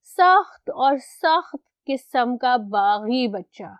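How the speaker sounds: ordinary talk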